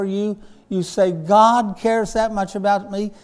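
full-force pulpit delivery; preaching. Acted. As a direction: preach it out to the congregation.

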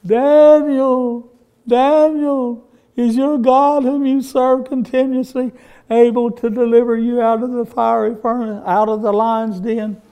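high, loud mournful call down into the den; lamentable holler. Retold or imitated. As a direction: imitate an anguished king crying out. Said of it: "he hollered in a lamentable voice. He's crying".

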